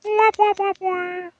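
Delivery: high sad trombone wah wah; mock sad trombone, sung high. Sad trombone after the joke.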